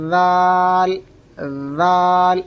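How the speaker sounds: long ذال with a soft voiced th as in "this", no buzz; tongue tip on upper teeth, stretch ذال gently; read softly. Shown as a demonstration. Teacher shows students the soft letter, drawn-out buzzless ذال. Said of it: "نرمی سے پڑھنا ہے".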